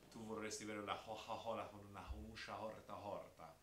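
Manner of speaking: Florentine Italian, every hard C breathed as h; Tuscan accent. Shown as a demonstration. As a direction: say it Tuscan, Coca-Cola as hoha-hola. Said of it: "but just in their accent".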